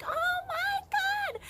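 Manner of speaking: very high, slow stretched oh my God; awed disbelief. Retold or imitated. Fan seeing the husband come out, very high and drawn out. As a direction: draw it out in star-struck shock.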